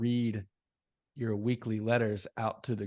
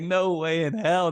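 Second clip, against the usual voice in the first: laughing disbelief; 7 semitones higher, 6 dB louder than usual. Retold or imitated. Retelling disbelief at letters.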